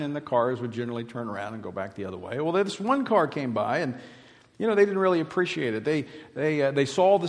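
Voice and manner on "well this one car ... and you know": ordinary talk, nothing marked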